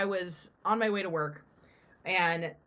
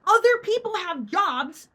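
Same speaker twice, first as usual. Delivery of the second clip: retold angry demand, pitched way up; 9 semitones higher, 5 dB louder than usual. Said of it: "And I'm like".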